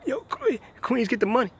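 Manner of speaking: high-pitched mock Queens hype voice; mocking Queens guy. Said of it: "some Queens idiot will say".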